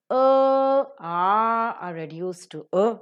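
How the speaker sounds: first two "A" held long and loud, last "A" a short schwa; long then weak vowel. Shown as a demonstration. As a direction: draw out "A, A", then reduce the final "A" to a brief schwa. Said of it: "long or the strong vowels are reduced".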